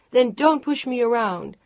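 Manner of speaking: furious wife yelling; angry shouting. Acted. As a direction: throw it in his face. Wife snapping back.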